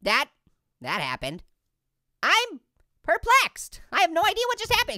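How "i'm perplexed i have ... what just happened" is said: mock bafflement.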